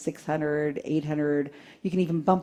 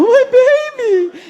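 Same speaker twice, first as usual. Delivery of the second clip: high, loud fake wail; mock grieving mother; 12 dB louder than usual. Pretending to grieve a son at the reading, loud high wail. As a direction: ham up the grief over a photo. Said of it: "And I'm, you know".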